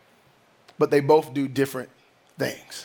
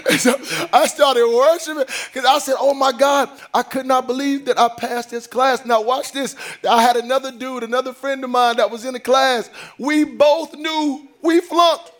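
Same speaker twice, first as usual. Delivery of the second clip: full preaching; 7 semitones higher than usual. Acted. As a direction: preach it to the congregation, building.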